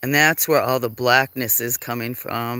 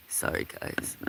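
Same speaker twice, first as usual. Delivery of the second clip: whispered aside to the viewers; whispered apology; 10 dB quieter than usual. Real, not acted. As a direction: breathe a quick sorry.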